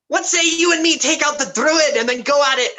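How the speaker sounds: high, fast, swaggering challenge; gruff brawler voice. Acted. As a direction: push the deal with cocky bravado. [name] challenges Errol in character.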